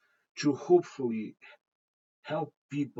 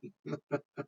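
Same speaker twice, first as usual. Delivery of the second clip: quiet ironic chuckle; ironic laugh; 7 dB quieter than usual. Real, not acted. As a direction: let out a soft, ironic little laugh. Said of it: "sorry for my ironic laughing".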